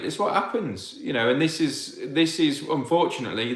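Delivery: normal talking voice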